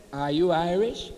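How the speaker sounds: lightly voiced West Indian accent; a hint of a West Indian lady's lilt; mild imitation of a West Indian accent. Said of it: "in her beautiful West Indian accent".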